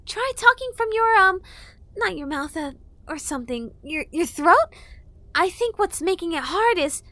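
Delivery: high, helpful fairy; chatty, bossy fairy; fairy girl voice. A narrator's reading. Fairy advising.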